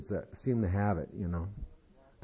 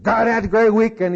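much higher, loud mock-eager outburst; excited boy; 11 semitones higher, 8 dB louder than usual. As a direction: burst out like an overeager kid. Boy boasts eagerly to the principal.

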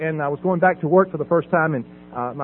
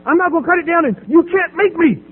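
put-on higher, taunting teenage sneer; mocking defiant teen; 9 semitones higher than usual. Son taunting father over the TV, mock defiant. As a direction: mimic the son daring his dad. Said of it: "He's going".

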